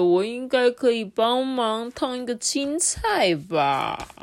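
slow, hopeful pot voice; hint you could help; bored pot musing. Acted. Pot musing to itself, slow and hopeful.

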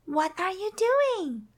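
a hint of a small, inquisitive mouse; Millie's voice. A narrator's reading. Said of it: "asked Millie".

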